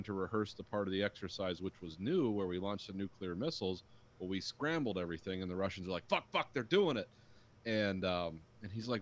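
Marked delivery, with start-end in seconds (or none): 6.1-7.1 higher, louder retold alarm; mock panic. Voicing the Russians fearing a missile launch, mock panic. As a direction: blurt it out in acted alarm.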